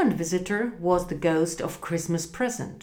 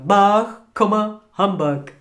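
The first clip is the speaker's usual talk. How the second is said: put-on grumpy old miser; 6 dB louder than usual. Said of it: "said Scrooge".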